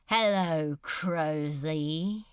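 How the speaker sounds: a hint of a strange, rasping demon voice; lightly voiced demon lord, slow and deliberate; a touch of menace in a foreign lilt; strange rasping accent. A narrator's reading. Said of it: "the strange accent".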